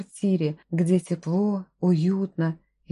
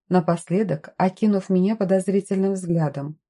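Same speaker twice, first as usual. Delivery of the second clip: ordinary delivery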